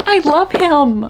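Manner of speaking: high, slow lovestruck gush; swooning. Real, not acted. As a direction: draw it out like a smitten fan. Swooning over a character.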